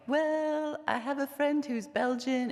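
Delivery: "I have a friend who's Belgian" voiced high in [name]'s light Belgian accent. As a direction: answer him still in [name]'s faint Belgian accent. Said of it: "How did you get that accent?".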